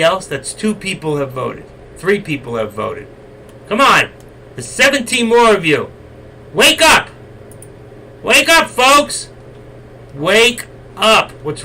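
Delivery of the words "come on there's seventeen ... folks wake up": high, loud shouts to wake the viewers; yelling at chat. Real, not acted. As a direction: bellow at the sleepy room. Host yells at silent chat.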